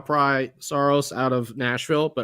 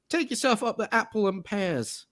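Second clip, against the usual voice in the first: high mock-Cockney British accent; hammed-up English rhyming-slang accent; bad British accent; 7 semitones higher, 4 dB quieter than usual. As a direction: put on a corny British accent. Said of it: "of a British accent".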